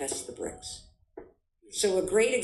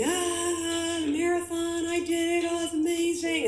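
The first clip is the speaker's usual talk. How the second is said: much higher, sing-song mock daydream; mock dreamy fantasy; 11 semitones higher than usual. Mocking how people daydream about the finish, sing-song. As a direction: coo it like a starry-eyed dreamer. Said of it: "instead of visualizing".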